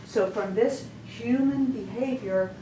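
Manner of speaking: normal talking voice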